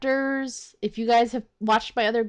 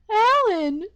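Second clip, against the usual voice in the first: high, loud call; character voice attempt; 11 semitones higher, 4 dB louder than usual. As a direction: call out in an acted voice. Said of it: "I don't remember the voice I get".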